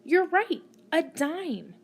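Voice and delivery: bright loud praise for a pupil; encouraging praise. Acted. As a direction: cheer the child on. Praising an unseen child's answer.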